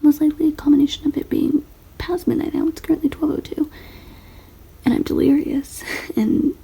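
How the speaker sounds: whispering. Real, not acted. Late-night whispered vlog.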